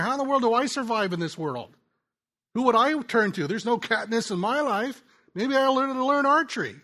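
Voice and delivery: voicing desperate people wondering how to survive; mock desperate asker. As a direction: play a lost person asking who to turn to.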